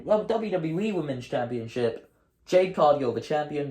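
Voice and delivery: normal talking voice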